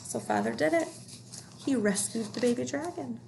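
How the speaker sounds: ordinary delivery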